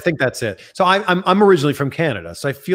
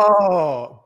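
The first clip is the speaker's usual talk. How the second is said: normal talking voice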